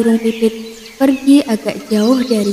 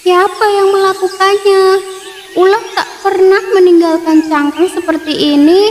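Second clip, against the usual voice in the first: higher, louder shocked bird; shocked sparrow; 8 semitones higher, 3 dB louder than usual. Acted. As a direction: cry out in dismay. Sparrows find broken eggs.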